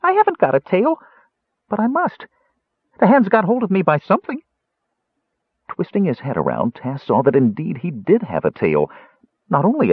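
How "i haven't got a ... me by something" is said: mouse squeak. A narrator's reading.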